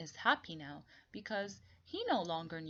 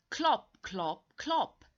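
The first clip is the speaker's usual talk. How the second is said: clip-clop sound words; 3 semitones higher, 6 dB louder, slower than usual. Acted. Donkey's hooves, read aloud.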